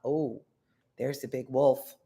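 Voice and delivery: plain reading voice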